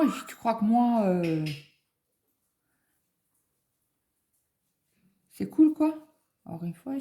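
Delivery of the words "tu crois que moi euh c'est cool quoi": ironic, rising higher and louder; mock him with irony; sarcastic retort. Mother retorts to scared son.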